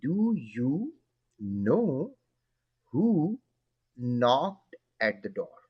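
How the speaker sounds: very slow, word by word, silent k; slow model reading. Shown as a demonstration. Teacher reading slowly for children: knocked with silent k. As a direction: read out slowly for the class to copy. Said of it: "silent हो गया".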